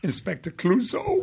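put-on Clouseau French accent; playful Clouseau accent. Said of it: "I sounded like Peter Sellers".

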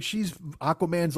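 normal talking voice

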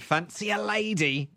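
Cockney streetwalker imitation, fairly restrained; Cockney prostitute. Retelling the London prostitute's offer, lighter Cockney imitation. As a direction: quote her in chimney-sweep Cockney. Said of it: "chimney sweep voice".

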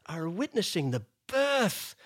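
slow, lofty showman praise; a lightly voiced grand emcee build-up; grand eloquent speech. Billy Connolly praising Marie to the audience, slow and lofty. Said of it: "did this beautiful, eloquent speech".

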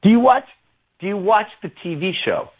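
high-pitched imitation of a rock star's daughter. Rock star's daughter asking about the show, imitated high voice. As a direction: lift the voice high to mimic her. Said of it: "And she goes".

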